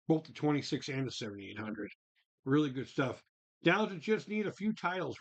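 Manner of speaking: ordinary talk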